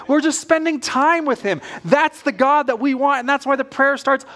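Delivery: ordinary talk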